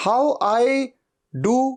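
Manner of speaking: halting, word-groups split by pauses; beginner's attempt. Teacher voicing what a beginner would think up, halting. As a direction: grope through it like a new English learner. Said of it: "शायद आप कुछ ऐसा सोचेंगे".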